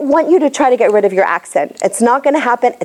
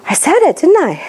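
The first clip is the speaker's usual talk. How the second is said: realising; 5 semitones higher than usual. Retold or imitated. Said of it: "they're gonna go like".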